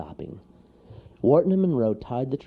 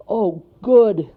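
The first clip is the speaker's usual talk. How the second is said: high, loud, delighted exclamation; thrilled little character; glad cry; 11 semitones higher, 12 dB louder than usual. A narrator's reading. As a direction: cry out with relief. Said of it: "cried Wharton".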